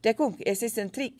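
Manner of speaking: shouting. A narrator's reading. Narrator shouting at the soldiers to take cover. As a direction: call it out urgently. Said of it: "I shouted".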